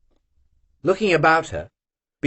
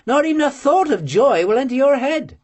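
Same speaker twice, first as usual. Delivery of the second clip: plain reading voice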